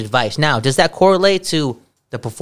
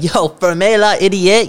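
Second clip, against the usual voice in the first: mock French retort; 5 semitones higher, slower than usual. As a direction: toss "ferme la idiot" back slowly. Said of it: "I'm just like".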